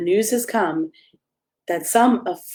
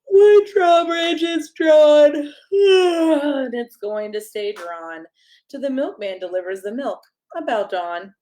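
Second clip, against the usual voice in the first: with a yawn; 5 semitones higher, 3 dB louder than usual. Acted. Old drawbridge drawer going to bed, saying it with a yawn. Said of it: "said with a yawn".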